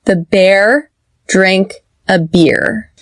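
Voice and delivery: slow contrast of "bear" and "beer"; slow model sentence. Shown as a demonstration. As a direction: say it slowly, open "bear", tense "beer". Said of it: "Repeat after me".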